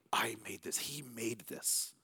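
soft breathy whisper, slowing at the end. Acted. Pastor voicing creation's message, reverent whisper. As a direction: hush it like a sacred secret. Creation shouting God's work.

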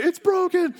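put-on high, loud panicked kid yell; screaming kid. Kid screaming to dad, high panicked yell. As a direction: squeal it in alarm. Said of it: "We're screaming".

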